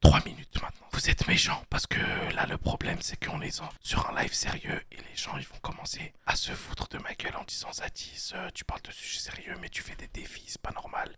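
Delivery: breathy ASMR whisper right into the mic. Pretend. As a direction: whisper it like an ASMR video, half joking. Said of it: "A S M R".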